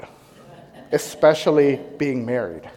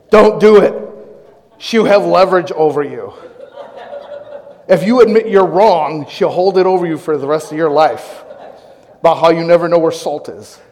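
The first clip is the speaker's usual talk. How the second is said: heart's stubborn plea; 4 dB louder than usual. Pretend. Heart urging the husband against apologizing, loud and insistent. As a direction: insist hard, as pride pleading. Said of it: "what my heart was saying".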